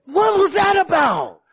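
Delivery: high-pitched, acted demand; mock exasperation. Preacher acting out a spouse losing their calm, mock exasperated. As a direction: pitch leaps up as forced calm slips.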